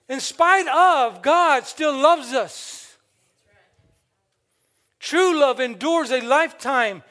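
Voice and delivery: loud, high, building sermon, slowing on the final words; full-force preaching. Acted. Pastor preaching on genuine love to the church, loud and building. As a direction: preach it out to the congregation.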